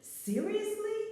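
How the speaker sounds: incredulous. Retold or imitated. The woman hearing the wrong name, incredulous. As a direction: shoot it up in disbelief. Reacting to being called Brenda.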